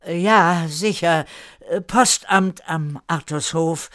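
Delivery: audibly uneasy. A narrator's reading. Newcomer agrees with Erika with a bad feeling, uneasy. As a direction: agree haltingly, unsure of it. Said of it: "antwortete mit einem hörbar unguten Gefühl".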